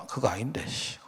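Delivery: whispered under the breath; hushed private protest, trailing away; inner grumble. Retold or imitated. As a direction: grumble to yourself, barely audible. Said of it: "그래서 속으로 내가".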